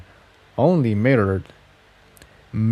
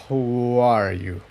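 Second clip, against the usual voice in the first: suspicious; 4 dB quieter, slower than usual. A narrator's reading. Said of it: "a sharp edge of suspicion in his voice".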